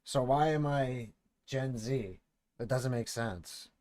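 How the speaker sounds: usual speaking voice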